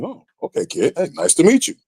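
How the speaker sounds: Batista. Retold or imitated. Star wrestler greeting a nervous rookie, casual imitation.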